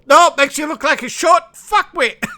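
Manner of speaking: high, loud comic bluntness dissolving into laughter; high hammed-up insult, cracking up; mock blunt insult. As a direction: deliver it as a cheeky put-down, then laugh. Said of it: "and Tyr says".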